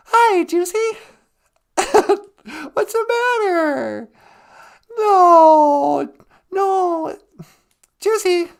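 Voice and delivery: high, whiny put-on caller voice; playing a distraught celebrity on the phone; mock Jussie caller. Host voices crying Jussie caller.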